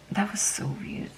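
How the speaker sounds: breathy whispered aside to herself; hushed, wondering whisper. Real, not acted. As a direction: drop to a whisper, half to yourself. Aside while recounting a strange dream.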